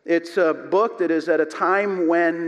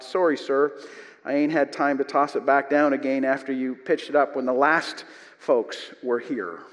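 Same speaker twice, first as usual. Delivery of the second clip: ordinary talk, nothing marked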